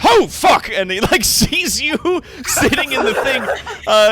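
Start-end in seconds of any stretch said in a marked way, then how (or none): 0.0-0.7 high, loud burst of shock; shocked shout; startled exclamation. Acted. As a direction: blurt it out in sudden alarm. Brewer suddenly notices the ancestor.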